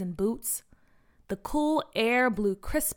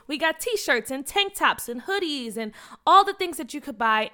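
ordinary delivery, nothing marked

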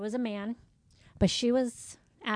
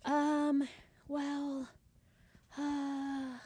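imitated drawn-out, higher-pitched hesitation; clueless stalling; 3 semitones higher, much slower than usual. Coworker forgetting why she called, clueless stalling. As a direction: stall blankly between each filler. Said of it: "she doesn't know, remember why she called them".

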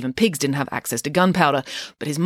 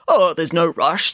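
slow, drawn-out casual king; feigned nonchalance; 4 dB louder, slower than usual. A narrator's reading. The king wants cake before it goes away, feigning nonchalance. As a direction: stretch it out as if in no hurry.

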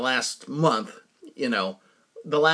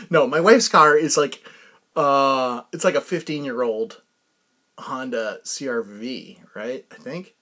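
ordinary talk, nothing marked

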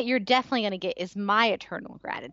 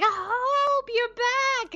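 very high delighted cry; high excited reunion squeal; squealing joy; 12 semitones higher, much slower than usual. Retold or imitated. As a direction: squeal with happy tears. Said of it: "I was like".

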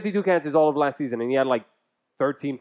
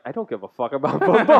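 mimicking Leanne; 4 dB louder than usual. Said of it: "she's like".

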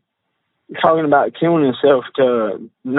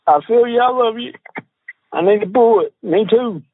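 high, strained voice, holding back tears; choked up; 10 semitones higher than usual. Real, not acted. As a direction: read it out much higher, overcome with emotion. Reading dead friend's last messages aloud.

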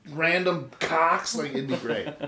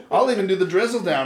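ordinary talk, nothing marked

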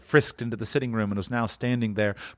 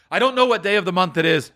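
quick, higher babbling; giddy excitement; 6 semitones higher, faster than usual. Acted. Scrooge not knowing the day, quick giddy babble. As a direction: rattle it off giddily.

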